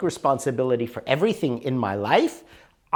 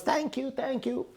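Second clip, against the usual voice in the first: high woman's thanks; 11 semitones higher, 6 dB quieter than usual. Retold or imitated. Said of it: "She goes".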